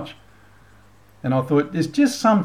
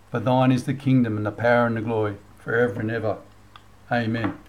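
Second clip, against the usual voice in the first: earnest, measured recitation of the Lord's Prayer; 3 semitones lower than usual. Acted. Vlogger leading viewers in the Lord's Prayer, reverent. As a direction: pray it steadily, leading the listeners.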